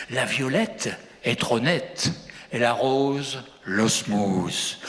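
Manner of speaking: low, measured poem recital with pauses; brother's accentless poetry voice. Acted. Farmer recites his poem as his city brother, low accentless recital. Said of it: "je prends la voix de mon frère".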